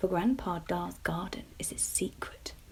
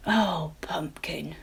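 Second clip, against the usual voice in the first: gentle papa; 2 semitones lower, 4 dB louder, much slower than usual. A narrator's reading. Papa gently telling daughter no, slow. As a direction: let her down gently.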